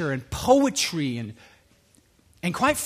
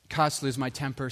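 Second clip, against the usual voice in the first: ordinary delivery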